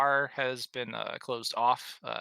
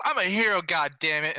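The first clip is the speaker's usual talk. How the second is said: yelling; 7 semitones higher, 8 dB louder than usual. Retold or imitated. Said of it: "He yells".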